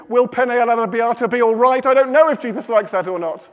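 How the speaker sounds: high, loud fretting; flustered worry. Acted. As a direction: fret aloud over the menu, comically. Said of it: "She doesn't know what to do".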